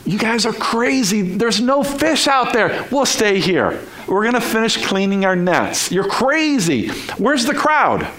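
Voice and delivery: high, dismissive protest; scoffing crew. Acted. Tired crew refusing to go back out, scoffing.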